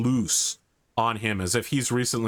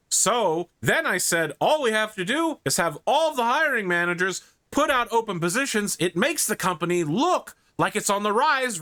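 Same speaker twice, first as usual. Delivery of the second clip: smug executive; 9 semitones higher than usual. Acted. NPC executive bragging to Lachlan.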